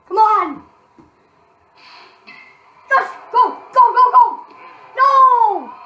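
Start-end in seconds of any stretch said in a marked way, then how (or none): 2.9-5.7 loud, high urging that turns to dismay; frantic gamer yell; urgent shouting. Real, not acted. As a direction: yell them on, then wail the letdown. Urging, then dismay at the play.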